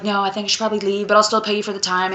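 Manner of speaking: fast, soft excuse; quick backing out. Retold or imitated. Doctor hurriedly backs out to the sex worker, fast. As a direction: rush the excuse out.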